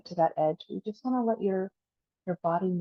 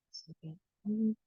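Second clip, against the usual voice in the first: easing softer as each breath is let go; guided breathing meditation; 3 dB quieter than usual. Acted. Teacher counting down the last breaths, guided meditation.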